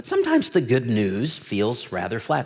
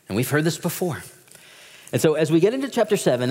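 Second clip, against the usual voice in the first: ordinary delivery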